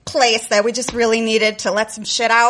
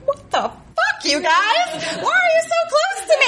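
much higher, fast, freaked-out squeal; mock panic. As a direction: blurt it like the wall is closing in. Freaked newbie in the wall.

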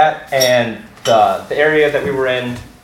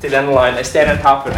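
ordinary talk

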